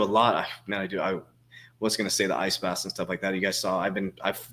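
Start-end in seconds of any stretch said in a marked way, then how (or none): none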